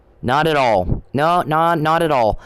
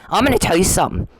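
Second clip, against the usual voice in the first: ordinary talk, nothing marked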